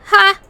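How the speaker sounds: sharp mocking "ha"; loud scoffing laugh. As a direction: bark out a scornful laugh. Scoffing loudly at the player.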